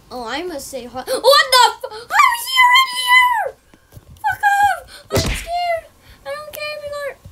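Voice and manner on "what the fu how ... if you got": shrieking, much higher and louder, laughing through it; panicked screaming. Real, not acted. Cousin suddenly shows up, panicked laughing shrieks.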